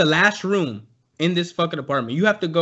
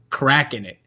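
ordinary talk, nothing marked

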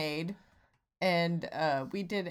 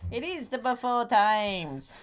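slow, higher cartoon voice; Rocco's Modern Life; 4 semitones higher, slower than usual. Co-host playing along on the pet phrase, cartoon voice. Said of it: "do a Rocco's Modern Life voice".

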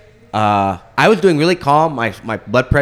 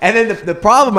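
higher and louder, laughter in the voice; laughing while talking; 10 semitones higher, 4 dB louder than usual. Real, not acted. Retelling waking up bawling, laughing through it. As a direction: talk through the giggles.

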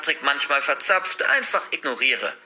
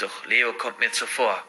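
ordinary delivery, nothing marked

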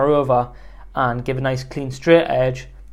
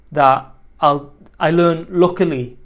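usual speaking voice

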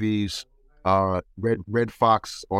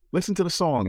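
higher eager mom voice; mother voice; 8 semitones higher than usual. Retold or imitated. Mother getting son to hear a song, higher eager voice. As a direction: urge him to listen.

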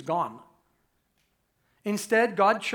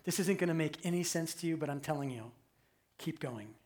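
much softer and lower, leaning in close; hushed urging; 6 semitones lower, 10 dB quieter than usual. Retold or imitated. Time traveller softly urges weary Israelite mother onward. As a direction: quietly coax a stranger to keep going. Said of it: "you say".

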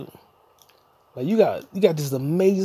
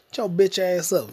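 ordinary talk, nothing marked

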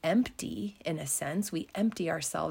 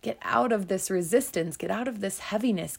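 normal talking voice

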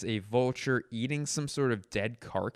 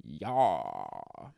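mock hawk yell; 8 semitones lower than usual. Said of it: "The hawk yelled".

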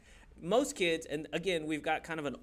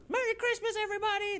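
much higher, gleeful sing-song shout; mock cheer; 4 dB louder, faster than usual. Mocking the preacher's married-couple line, high gleeful cheer. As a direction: squeal it like unwrapping presents.

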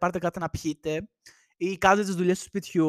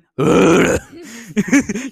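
loud wordless booming imitation of the neighbour; imitated thundering roar; 10 dB louder than usual. Podcaster mimicking the loud neighbour next door, thunderous mock roar. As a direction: boom out a mock thunderous noise. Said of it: "έχει και μια βροντερή φωνή".

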